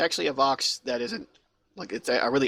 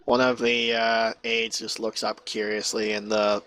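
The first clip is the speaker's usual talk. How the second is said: ordinary talk, nothing marked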